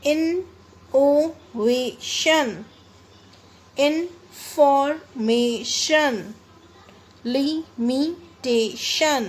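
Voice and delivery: each word from ability to experiment in four separate beats; syllable by syllable. Shown as a demonstration. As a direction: break words like calculation into four, pausing after each syllable. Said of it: "इसमें आपको four break आएंगे".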